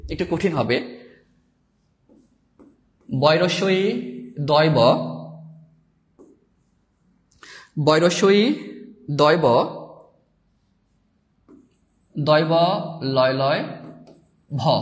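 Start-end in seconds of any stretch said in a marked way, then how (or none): 3.2-14.7 slow letter-by-letter spelling, pausing between each. Shown as a demonstration. Teacher spelling out an awkward title for students, slow dictation. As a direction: spell each letter deliberately, with long gaps. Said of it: "মানে বানানটা একটু বিদঘুটে".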